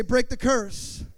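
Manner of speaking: rally them to shout it back, drawn out; preached call. Shown as a demonstration. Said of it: "Somebody say".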